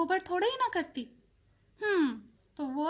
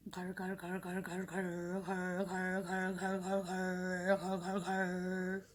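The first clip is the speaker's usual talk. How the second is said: machine rumble; 6 semitones lower, 7 dB quieter, much slower than usual. A narrator's reading. Machine noise in story.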